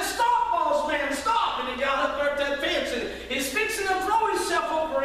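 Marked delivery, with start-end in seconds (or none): 0.0-1.6 loud, slow, high call of stop; shouting stop. Retold or imitated. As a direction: yell to stop him at the fence. Sam running after boss shouting.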